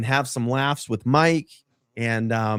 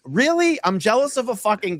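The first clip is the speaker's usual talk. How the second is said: very high mocking "Really?"; sarcastic high squeal; incredulous; 9 semitones higher than usual. Real, not acted. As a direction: scoff in disbelief. Incredulous retort.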